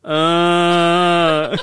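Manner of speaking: long, low, steady groan; low drawn-out "uhhh"; mock dying groan. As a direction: groan like a bad actor playing wounded. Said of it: "you hear Melvin".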